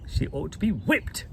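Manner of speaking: a stern headmistress pronouncing sentence; proclaiming. A narrator's reading. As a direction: declare it coldly, from above. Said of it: "Miss Minchin proclaimed".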